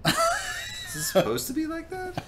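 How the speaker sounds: very high, slow squeaky mockery; teasing falsetto question. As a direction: squeak it out like a cartoon. Mocking friend's odd hand.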